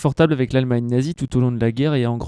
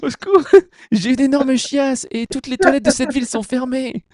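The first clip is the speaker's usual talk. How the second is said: panicked citizen; 10 semitones higher than usual. Pretend. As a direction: beg the hero for rescue. A distressed citizen shouting for help.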